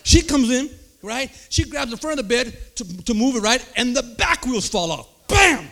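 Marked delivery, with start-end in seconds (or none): 5.3-5.7 shouted crash sound, high and loud; loud bam. Acted. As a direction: punch the impact out. Wheels crash sound effect.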